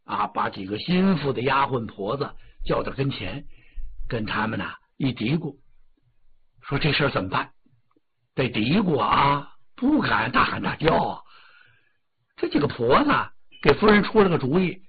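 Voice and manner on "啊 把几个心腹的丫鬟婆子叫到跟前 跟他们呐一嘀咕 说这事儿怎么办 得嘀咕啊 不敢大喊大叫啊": soft, secretive murmur; hushed conferring. A narrator's reading. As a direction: lower the volume as if consulting trusted servants.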